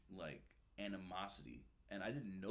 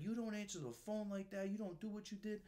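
angry yelling; 12 semitones higher than usual. Retold or imitated. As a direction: tear into him like a furious gunny. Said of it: "He's like".

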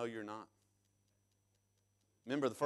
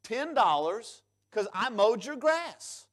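demanding payment; 7 semitones higher, 4 dB louder than usual. Retold or imitated. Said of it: "goes to the yard owner and says".

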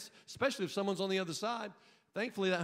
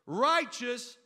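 usual speaking voice

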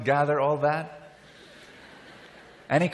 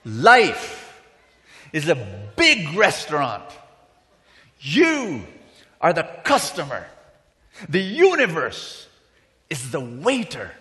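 loud proclamation with long pauses; forceful preaching; 6 dB louder than usual. Real, not acted. Preacher reveals the meaning of the restaurant story, forceful proclamation. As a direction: hammer each key word home.

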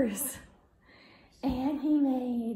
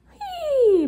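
excited whee; 11 semitones higher, 9 dB louder than usual. Acted. Horse riding play.

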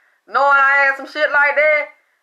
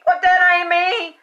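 retold disbelief; 6 semitones higher than usual. Retelling her shocked reaction.

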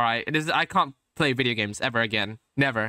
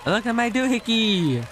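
high, drawn-out hurrahs; mock cheering; 8 semitones higher, much slower than usual. Player cheers along with the game's intro, mock cheering. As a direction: whoop and show off the gadget in a high voice.

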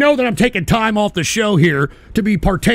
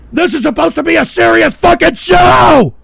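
much higher and louder, exasperated; furious shout; 9 semitones higher, 7 dB louder than usual. Real, not acted. Streamer shouting that his show should be serious, furious. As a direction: rage at the ruined show.

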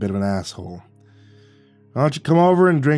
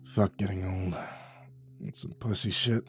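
quiet answer; 2 semitones lower, 10 dB quieter than usual. Acted. The old vet shrugs off aging, quiet dry answer. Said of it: "quietly answered".

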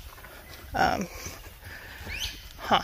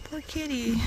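very soft, higher-pitched coo; tender pity; 4 semitones higher, 13 dB quieter than usual. Real, not acted. Walker pitying a hungry stray cat, tender pity. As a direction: coo it quietly to a little animal.

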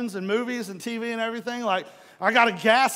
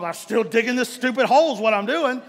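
frustrated retort; 4 semitones higher, 7 dB louder than usual. Retold or imitated. Frustrated husband answering family.

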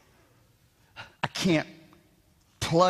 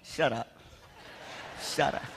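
soft, much lower mock mutter through a laugh; under his breath; 8 semitones lower, 8 dB quieter than usual. Son privately answering the grandmothers, in a soft, low, laughing mock grumble. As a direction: grumble it half to yourself, laughing. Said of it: "I'm going".

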